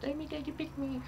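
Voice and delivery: yarn voice. Pretend. Voicing the yarn's message.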